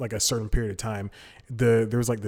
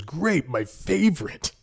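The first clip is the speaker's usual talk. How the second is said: slow, higher, savoring each word; delighted; 5 semitones higher, slower than usual. Retold or imitated. The guy learns it is grape, slowly delighted. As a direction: relish it like a treat. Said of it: "so excited".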